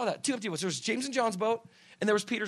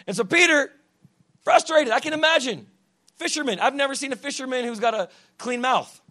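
raised, emphatic preacher's voice; forceful preaching; 8 semitones higher, 9 dB louder than usual. Real, not acted. Preacher explaining to the congregation how business people think, forceful preaching. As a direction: drive the point home loudly.

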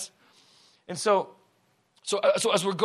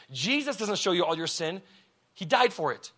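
normal talking voice

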